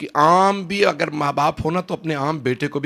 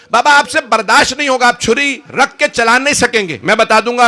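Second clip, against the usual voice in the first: pleading lament; 10 semitones higher, 8 dB louder than usual. Acted. Son pleading with father in story.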